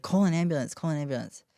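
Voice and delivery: high, loud urgent command fading on the repeat; urgent order. Retold or imitated. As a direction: order it twice, panicked.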